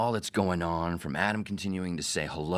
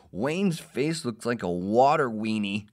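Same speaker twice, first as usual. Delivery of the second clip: mocking tease; 5 semitones higher, 5 dB louder, slower than usual. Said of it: "and he goes".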